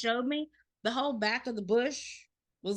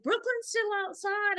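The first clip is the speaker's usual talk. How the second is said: daughter's quote; 11 semitones higher than usual. Retold or imitated. Daughter quoted calling out warning.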